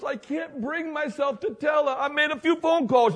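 sly confession. Retold or imitated. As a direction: own up with a wink. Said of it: "And he goes".

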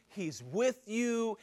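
normal talking voice

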